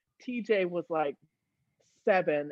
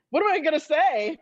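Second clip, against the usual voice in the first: mock panic; 8 semitones higher, 7 dB louder than usual. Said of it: "I'm like".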